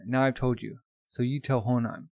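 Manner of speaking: ordinary talk, nothing marked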